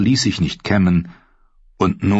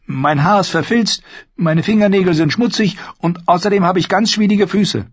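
put-on high, girlish Arya voice; young girl voice; 12 semitones higher than usual. A narrator's reading. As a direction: voice her as a young girl, much higher.

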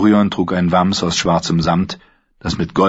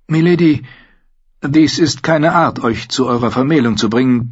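higher, earnest courtly apology; apologetic Tyrion; 6 semitones higher than usual. A narrator's reading. Tyrion to Sansa, sincerely apologising. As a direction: make amends sincerely.

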